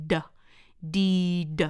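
D as letter name, then a voiced "duh"; isolated, voiced D for a phonics class; voiced D sound. Shown as a demonstration. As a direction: say D with the throat buzzing, "dee, duh". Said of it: "เป็นเสียงขุ่นๆ เป็นเสียง voiced".

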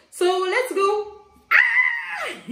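high, slow, shouted cheer; excited shout. Real, not acted. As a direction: yell it out with joy. Excited start of unboxing.

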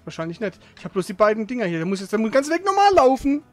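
ordinary talk, nothing marked

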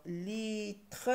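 slow "litre", split in two; drawn-out, deliberate "litre"; syllable by syllable. Shown as a demonstration. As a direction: hold "li", then a full "tre". Teacher trying out syllables.

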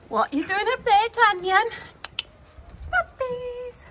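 high sing-song coo. Real, not acted. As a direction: coo at a kitten. Cooing to kitten.

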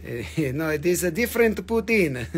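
hammed-up, badly faked French accent; clumsy mock-French accent, extra vowels after words. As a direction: attempt a French accent that drifts toward Borat. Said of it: "my French accent is very bad".

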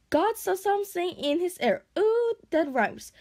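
high, playful singsong; singsong dragon. A narrator's reading. Toothless makes a rhyme to ignore Hiccup, high singsong. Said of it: "sang Toothless".

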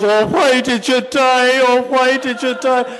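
high, slow keening wail, mock grief; high drawn-out mock lament; mock inconsolable wailing. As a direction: wail it like a grieving widow, hammed-up. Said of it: "She just keeps crying out".

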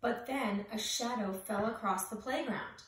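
plain reading voice

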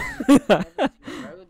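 high, loud words through laughter; cracking up mid-sentence; laughing. Real, not acted. Mocking an enemy to his teammate, laughing loudly.

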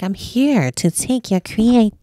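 slow, lightly voiced demon menace; a hint of a looming demon; slow mock demon announcement; art demon voice. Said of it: "like, art demon".

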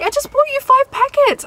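high, loud mock-exasperated mother; exasperated mum. Mum complains to teen about super glue, exasperated and shrieky. Acts exasperated mum's reply.

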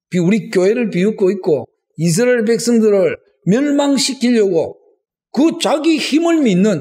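high-pitched indignant protest to God; 따지는 기도. Acted. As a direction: accuse God in rising outrage, easing on the final question. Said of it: "따지는 그런 사람이 있었습니다". (높은 목소리로 분개하며 따지는 기도)

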